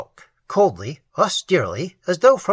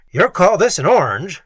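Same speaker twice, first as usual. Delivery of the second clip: gruff, loud complaint; angry gruff; 4 semitones higher, 6 dB louder than usual. A narrator's reading. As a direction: growl it in disgust. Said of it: "followed by an angry grunt".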